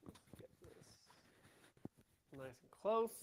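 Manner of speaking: barely audible, far from mic; faint voice on a weak mic; faint audio. Real, not acted. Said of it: "Not much better".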